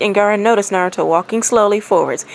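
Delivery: plain reading voice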